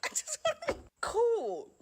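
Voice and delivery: high, loud, drawn-out mock plea; mock wail. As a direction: wail it slowly, hammed up. Mock hurt plea in own story.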